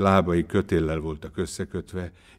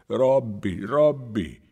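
raised, louder pleading cry; mild acted sobbing; 5 semitones higher, 5 dB louder, much slower than usual. A narrator's reading. Wretched man looks up at his master, mildly sobbing. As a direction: call out in storybook anguish. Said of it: "zokogni kezdett".

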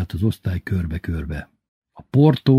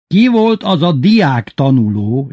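slow, loud, higher-pitched interrogation; stern headmaster quoted; 5 semitones higher, 5 dB louder, slower than usual. A narrator's reading. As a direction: drag out the official question. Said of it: "így mondta". (szigorú, vontatott igazgatói hang)

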